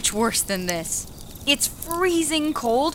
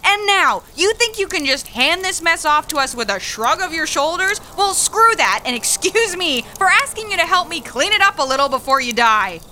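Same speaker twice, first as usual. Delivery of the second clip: louder, higher furious tirade; 5 semitones higher, 5 dB louder than usual. Acted. Young worker telling older woman to help clean up, furious shout. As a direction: lash out at her, full of contempt.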